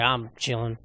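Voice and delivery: low, soft, unbothered guy; understated Midwestern dude impression; laid-back Kansas dude. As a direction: play it chilled-out and low.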